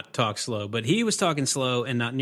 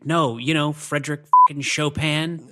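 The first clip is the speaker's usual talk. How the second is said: louder, punchy movie-quote retort; retold emphatic retort; 3 semitones higher, 5 dB louder than usual. Retold Doc Holliday movie retort.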